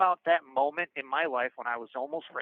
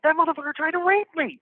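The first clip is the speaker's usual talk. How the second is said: high, loud imitated outcry; retold yell; 13 semitones higher, 6 dB louder than usual. Scared inmate yelling to his bunkie, high and loud. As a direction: yell the accusation out, pitched way up. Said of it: "and I yell out".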